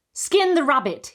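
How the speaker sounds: sudden loud, high command; commanding order. A narrator's reading. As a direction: bark the order at her. Erlking gives an order.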